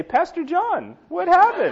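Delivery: high-pitched startled voice; mock astonishment. Imagined churchgoer spotting the pastor, high mock shock. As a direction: squeak it in comic surprise.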